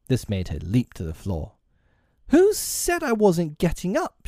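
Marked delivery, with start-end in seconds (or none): 2.3-4.3 high-pitched fairy protest; indignant cry. A narrator's reading. Tink leaping up at Peter's threat, high indignant cry. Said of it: "she cried".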